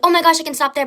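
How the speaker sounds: high, loud burst of relief at seeing help; relieved excitement. Retold or imitated. Driver spotting a cop at the gas station, excited relief. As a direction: blurt it out like you've found a lifeline. Spots a cop while being followed.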